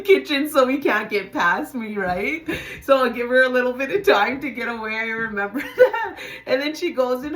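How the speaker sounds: can't hold the laugh while telling it. Real, not acted. Remembering blocking the cop in the kitchen, laughing out loud.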